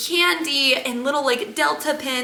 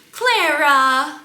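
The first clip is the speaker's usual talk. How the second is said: raised, louder drawn-out name; mimicked cooing call; 4 semitones higher, 6 dB louder than usual. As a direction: imitate an attendant calling to a baby. Acting out attendant addressing baby.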